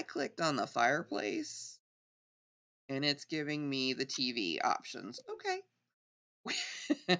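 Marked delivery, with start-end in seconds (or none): 5.3-7.2 squeaky drawn-out okay, amused; amused high okay. Real, not acted. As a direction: stretch it up high in surprise.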